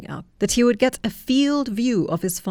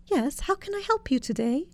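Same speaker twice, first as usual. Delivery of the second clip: high, soft, timid assistant voice; meek little speaker answering; quivering AI voice; 10 semitones higher, 5 dB quieter than usual. A narrator's reading. Small speaker answering the giant, high and timid.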